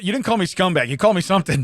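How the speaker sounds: ordinary delivery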